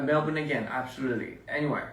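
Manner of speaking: ordinary talk, nothing marked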